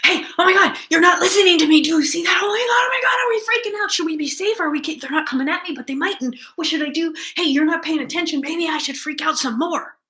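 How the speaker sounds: high, loud, frantic shouting; a reactive dog yelling at an owner who ignores it, panicked; panicking dog voice. Retold or imitated. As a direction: spiral into a full freakout. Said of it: "the dog is like".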